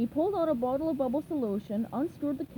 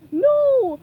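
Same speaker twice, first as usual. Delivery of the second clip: much higher, much louder panicked yell; cry out; 13 semitones higher, 14 dB louder than usual. A narrator's reading. Plankton begs SpongeBob to stop, loud high panicked yell. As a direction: shriek it in terror. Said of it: "Plankton cried".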